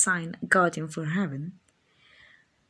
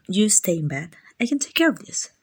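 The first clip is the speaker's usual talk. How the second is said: ordinary talk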